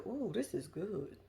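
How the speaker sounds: savoring hush. Retold or imitated. Watching the fight unfold, soft slow relish. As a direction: lean in and savor the gossip.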